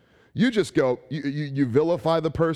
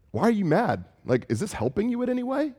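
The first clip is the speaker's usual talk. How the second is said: concerned God; 8 semitones higher than usual. Retold or imitated. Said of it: "God's saying".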